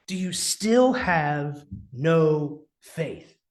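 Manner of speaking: "Do you still have no faith?" drawn out slowly, each word weighed; slow stern rebuke. Acted. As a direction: lean on the disappointment, slowly. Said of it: "harsher rebuke".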